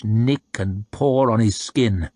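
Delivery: usual speaking voice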